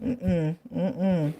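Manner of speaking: normal talking voice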